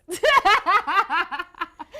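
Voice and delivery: big bright laugh; loud laugh. Real, not acted. Laughing after joke.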